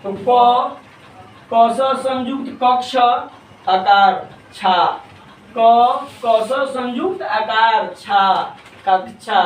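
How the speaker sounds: slow, syllable-by-syllable spelling-out of कक्षा, then the whole word; हिज्जे करके उच्चारण. Shown as a demonstration. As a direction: spell out क, क स संयुक्त, आकार छा, stretching each piece. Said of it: "कक्षा का उच्चारण हम लोग कैसे करेंगे". (धीरे-धीरे हिज्जे करके कक्षा का उच्चारण)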